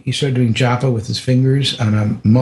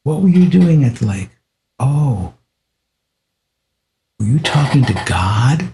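teasing guru; 3 semitones higher than usual. Retold or imitated. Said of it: "he started teasing me".

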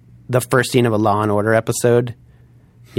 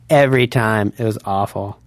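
ordinary delivery

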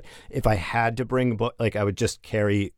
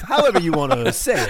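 laughing; 10 semitones higher, 4 dB louder than usual. Real, not acted.

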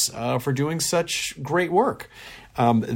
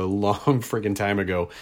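normal talking voice

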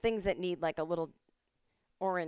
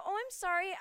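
much higher flustered sorry; meek apology; 13 semitones higher than usual. Retold or imitated. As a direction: squeak out an apology. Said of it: "And I go".

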